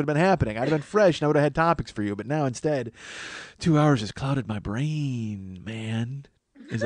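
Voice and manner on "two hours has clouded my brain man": hippie voice. Pretend. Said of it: "I say that like a hippie".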